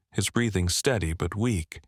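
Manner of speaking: ordinary delivery, nothing marked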